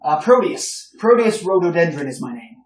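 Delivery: put-on older gentleman greeting the heroes, loud and hearty; boisterous older gentleman. Old gentleman introduces himself.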